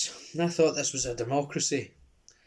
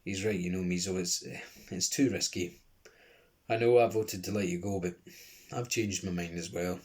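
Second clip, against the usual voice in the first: sad; 6 semitones lower, 4 dB quieter than usual. A narrator's reading. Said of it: "shook his head sadly".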